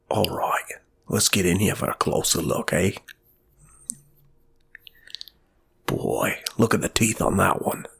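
hushed Australian wildlife-host whisper; Steve Irwin whisper. Retold or imitated. Retelling a comedian's gynecologist bit, whispered in an Australian accent. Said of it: "Steve Irwin".

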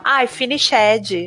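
"finished" said slowly as "finish-ED-jee"; mock Brazilian English, "finished" with a stressed -ed and extra vowel; Brazilian mispronunciation. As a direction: stretch "finished" into three syllables with a final -dji. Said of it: "a pessoa é brasileira se ela começar a falar".

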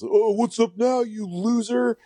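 high-pitched mocking heckler; mock taunting. People coming at him over an old tweet, mock taunting. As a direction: imitate a jeering critic, pitched well up.

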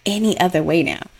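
ordinary talk, nothing marked

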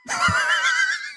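a sudden high burst of laughter. Real, not acted. Laughing at wrestler's misunderstanding.

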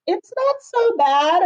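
high, slow and sugary, trying to be kind; a kid sweetly softening bad news to a friend; consoling kid. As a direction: coax it gently like a sweet child. Kind kids consoling the classmate.

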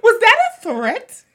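high, loud mock shock; mock outrage. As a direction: squeak it in fake alarm.

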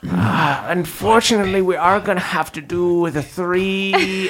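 gleeful mad scientist; mad doctor voice. Acted. Lanfizz cheerfully cramming them.

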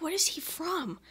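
hushed, secret guess; whispering at the booth; whispered aside. Retold or imitated. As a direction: whisper it to a friend. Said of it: "I'm like".

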